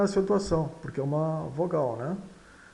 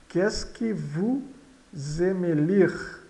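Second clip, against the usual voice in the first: slow, broken after vous, rising at the end; slow model reading; slower than usual. Shown as a demonstration. Teacher modelling a French question for learners, slow and careful. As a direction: read it slowly in two parts for learners.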